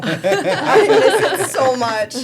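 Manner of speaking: slow, laughing through the words. Real, not acted. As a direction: laugh while longingly confessing. Misses discontinued menu item, laughing.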